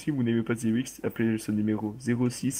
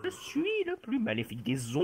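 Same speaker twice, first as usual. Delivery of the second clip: evil zombie voice; 13 semitones higher than usual. Pretend.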